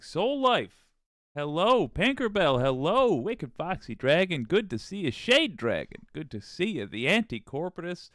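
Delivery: loud, high, bouncy shout-outs to chat; excited greetings. Real, not acted. Streamer greeting his chat by name, excited and loud. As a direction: greet each viewer with big energy.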